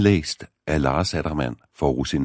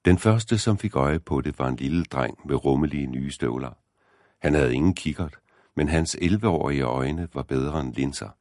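ordinary delivery

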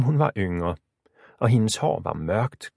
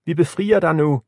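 high, raised-voice promise; calling back; 6 semitones higher, 5 dB louder than usual. A narrator's reading. Lisa promises to free her sister, calling loudly. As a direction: call out to reassure from afar. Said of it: "råbte Lisa tilbage".

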